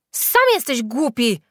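slow, high, loud comeback; angry retort. A narrator's reading. Julka answers the freckled boy, calling out angrily. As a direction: throw the insult back loudly. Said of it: "zawołała Julka".

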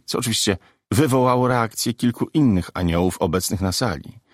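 ordinary delivery, nothing marked

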